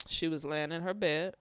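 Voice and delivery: normal talking voice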